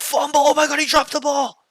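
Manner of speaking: very high, loud, excited shout; frantic high-pitched crowd-style yell; yelling spectator. Acted. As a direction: shriek like a fan watching the game. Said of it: "people even watching the game will be like".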